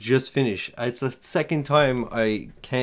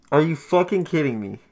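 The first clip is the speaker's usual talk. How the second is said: angry disbelief; 2 semitones higher than usual. Real, not acted. Race lost at the last track.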